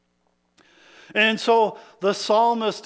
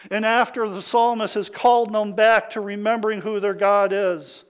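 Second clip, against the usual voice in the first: ordinary talk, nothing marked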